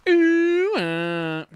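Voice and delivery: high, loud, drawn-out whale moan; Dory speaking whale. Retold or imitated. Streamer doing Dory speaking whale, high loud moan. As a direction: stretch it like a whale call. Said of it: "Dory be like".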